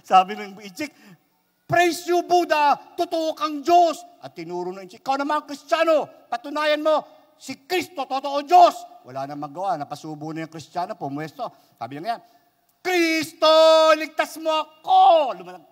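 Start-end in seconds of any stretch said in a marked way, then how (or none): 1.7-4.0 high, loud triumphant cry; ringing high shout of praise; exultant praise. Acted. Saved man praises Buddha. 5.0-9.0 high, loud taunting dare; triumphant shouted dare; loud challenge. Acted. 12.8-15.4 high, loud drawn-out cry for rescue; desperate high holler; shouted plea. Acted.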